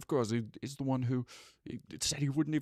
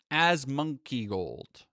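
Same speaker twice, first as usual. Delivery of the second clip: ordinary talk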